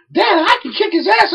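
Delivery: high, mock kid voice; eager son. Son offering to fight the boy, high and eager. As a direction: play the eager little brother.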